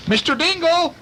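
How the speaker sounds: a sudden pained cry of a name; yelped. A narrator's reading. Little scout kicked, yelping the leader's name. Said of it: "One of the little ones yelped".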